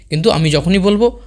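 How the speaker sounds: ordinary talk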